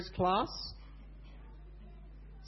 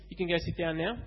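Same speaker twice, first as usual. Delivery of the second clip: ordinary talk, nothing marked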